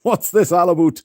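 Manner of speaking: Canadian "aboot" on about; playful, lifted Canadian impression; mock Canadian. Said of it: "Eugene's been saying a boot".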